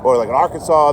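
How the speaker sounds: ordinary talk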